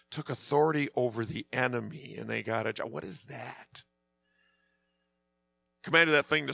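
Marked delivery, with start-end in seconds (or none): 0.1-3.8 low, hushed puzzlement; baffled eavesdropper. Retold or imitated. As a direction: quietly mimic an outsider puzzling over jargon. Acting baffled eavesdropper repeating church jargon.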